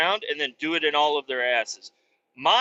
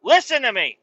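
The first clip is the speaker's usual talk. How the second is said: stern scolding; 5 semitones higher, 6 dB louder than usual. Retold or imitated. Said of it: "He's like".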